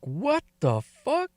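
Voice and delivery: slow, high-pitched what the fuck; high stunned disbelief. Retold or imitated. Telling Ava about the strange gift, slow high stunned disbelief.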